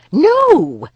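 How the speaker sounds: high, loud shouted no; sharp refusal. Acted. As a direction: bar the door with one cry. Nessa refuses a man.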